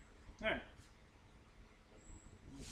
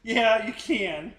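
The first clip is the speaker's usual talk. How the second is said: loud laughing yes, drawn out slowly; 5 semitones higher, 11 dB louder, much slower than usual. Real, not acted. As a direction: laugh it out while agreeing. Laughing agreement about cheap planes.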